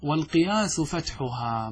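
normal talking voice

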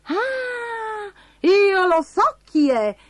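high, stretched cry of realisation; excited exclamation. Acted. Bastoncello solving the first clue, high excited exclamation. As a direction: burst out with the answer, pitched high. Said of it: "esclamò Bastoncello".

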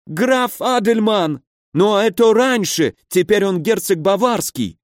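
high-pitched, pained wailing; panicked injured baron. A narrator's reading. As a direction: plead in high, agonised bewilderment. (высокий страдальческий голос перепуганного барона)

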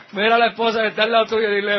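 loud, quick instruction through laughter; laughing. Real, not acted. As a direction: tell the crowd while cracking up. Laughing at his own punchline.

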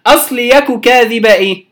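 ordinary delivery

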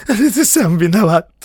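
loud, high, tearful blame; whimpering wail; sobbing. A narrator's reading. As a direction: sob it out accusingly. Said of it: "как-то всхлипнул Бильбо".

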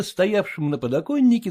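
plain reading voice

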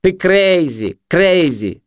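high mocking singling-out; high-pitched jeer; jeering junkie. A narrator's reading. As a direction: taunt him in a high voice.

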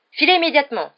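loud, high command; shouted order. A narrator's reading. Percy orders Ron's group to leave, shouting. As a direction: order them away urgently. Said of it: "s'écria Percy".

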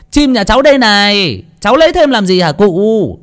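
high, loud mocking voice through laughter; cheeky laughing taunt. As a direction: tease the old man while still laughing. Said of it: "cười ha hả".

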